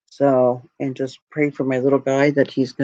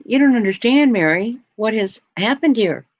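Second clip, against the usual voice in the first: high, rising, frantic protest; exasperated panic; 8 semitones higher than usual. Retold or imitated. Protesting to calm Mary, high and frantic. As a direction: plead with her that it's serious. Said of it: "And I'm thinking".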